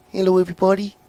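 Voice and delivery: mocking a high, bassless voice; squeaky nerdy singer voice; high thin voice. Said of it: "didn't have no bass to it".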